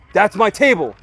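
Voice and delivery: loud, high mock yell at him; imitating a man yelling in his face; retold yell. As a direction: bark the line like an angry stranger. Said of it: "He, like, yells in my face".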